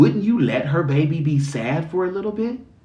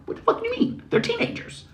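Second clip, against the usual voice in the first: much higher, fast incredulous squeal; mock exasperation; 5 dB quieter, faster than usual. Mocking people who don't get teenagers, high squeal. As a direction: squeal in disbelief at the fuss.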